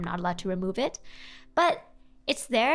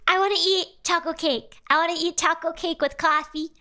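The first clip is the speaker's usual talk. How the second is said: put-on high, pleading little-kid voice; childish whine; 6 semitones higher than usual. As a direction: beg for cake like a kid. Childlike craving voice.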